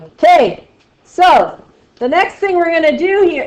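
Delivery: high, loud shouted lesson voice; mock screaming teacher. Teacher mocking her own podcast voice, shouting high and loud. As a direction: imitate herself shouting the lesson. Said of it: "that's what I sound like".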